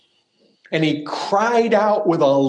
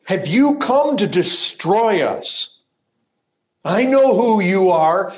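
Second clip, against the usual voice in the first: louder and higher, slowed and pressing; frightened demon outcry; 5 semitones higher, 4 dB louder than usual. Acted. Demon faces Christ with fearful loud voice. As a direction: cry it out in fear. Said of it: "You see it there".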